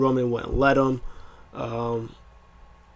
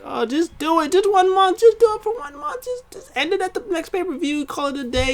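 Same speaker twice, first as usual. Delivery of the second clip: much higher, pleading and straining; pleading fan. Retold or imitated. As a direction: beg them to just give him the title. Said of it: "I was like".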